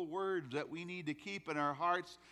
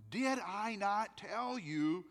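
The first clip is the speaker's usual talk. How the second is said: 1 voicing Jesus; 5 semitones higher than usual. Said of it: "Hear the exact words".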